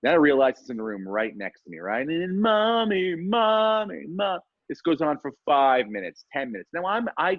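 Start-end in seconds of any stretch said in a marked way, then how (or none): 2.4-4.4 put-on high, drawn-out child's whine; whining kid. Kid calling mom through a hotel wall, mock whining. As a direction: imitate a child wailing for a parent. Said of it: "it's kid whining".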